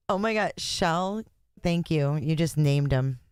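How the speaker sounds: ordinary talk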